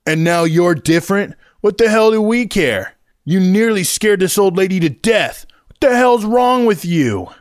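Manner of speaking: put-on high, loud, slowing teen tirade; angry teen. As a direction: tear into him, voice pitched high. Teen berating the hero.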